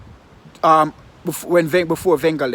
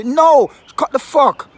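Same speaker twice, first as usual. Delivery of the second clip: sudden loud, high angry shout; furious outburst; 8 semitones higher, 6 dB louder, slower than usual. Real, not acted. Fan raging at Ozil's critics, furious shout. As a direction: explode at the critics, full fury. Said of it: "I'm really fucking pissed off".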